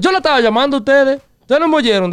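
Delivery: fast, high-pitched Spanish outburst; agitated retold protest. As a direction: imitate pleading that they must have heard you. Retold protest to mom and grandma.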